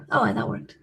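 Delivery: ordinary talk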